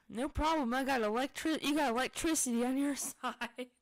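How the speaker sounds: loud, high infomercial pitchman voice. Pretend. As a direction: sell it like a TV pitchman. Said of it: "you sound like an infomercial".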